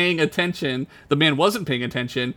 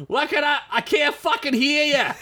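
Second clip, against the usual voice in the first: much higher mock shout with a laugh; mock yelling back; 12 semitones higher than usual. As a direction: call back from far away, Boston accent. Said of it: "And she responds".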